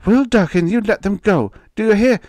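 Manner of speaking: high, loud order to husband; fierce protective mother's cry; outraged demand. A narrator's reading. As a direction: shout in defence of the children. Wife demands children be released.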